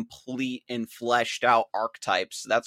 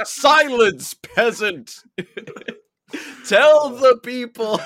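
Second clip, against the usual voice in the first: mock royal decree; 10 semitones higher, 7 dB louder, slower than usual. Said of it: "And he's like".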